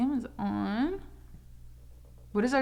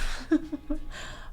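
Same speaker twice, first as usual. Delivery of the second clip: breathy laugh; 7 semitones higher, 4 dB quieter than usual. Real, not acted. After mocking imagined viewer.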